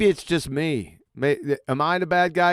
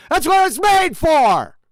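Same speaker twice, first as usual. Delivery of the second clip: slow, furious yell, higher and louder; angry shout; 8 semitones higher, 5 dB louder, slower than usual. Real, not acted. Yelling at the caller in the debate, furious shout. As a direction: hammer each word out in rage.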